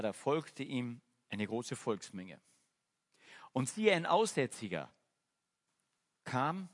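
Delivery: measured reading of the verses; scripture reading. Acted. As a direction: read the gospel passage aloud to the congregation. Reads Bible verses aloud.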